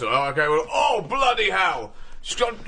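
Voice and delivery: shouted, drawn-out officer's cry; posh major. Retold or imitated. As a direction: yell like a stuffy old major. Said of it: "the major goes".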